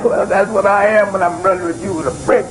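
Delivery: normal talking voice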